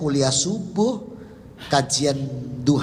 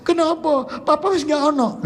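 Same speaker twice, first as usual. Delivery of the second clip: tearful family; 4 dB louder than usual. Retold or imitated. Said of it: "semua nangis semua".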